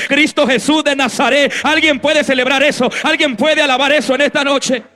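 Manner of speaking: loud, high fervent preaching, every phrase hammered home; preaching at full force. Acted. Pastor stirring up the congregation, loud fervent preaching. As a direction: preach it out to the whole hall, rising and insistent.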